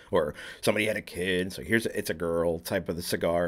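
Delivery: ordinary delivery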